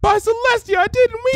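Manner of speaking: very high, breaking desperate plea; desperate pleading. A narrator's reading. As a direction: beg through the panic. Trixie begs.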